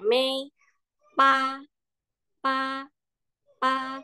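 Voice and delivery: "May" and "pa" high and level; first-tone demo. Shown as a demonstration. Teacher matching pa to may, high level tone. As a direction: chant "pa" on one steady high note. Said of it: "dia tinggi nadanya".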